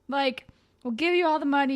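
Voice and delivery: hurried mock plea to the gunman; pleading girl. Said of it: "she's like".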